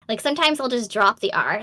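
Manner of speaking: ordinary talk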